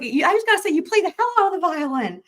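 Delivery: echoing the caller's delighted praise. Retold or imitated. Voicing the lawyer's praise.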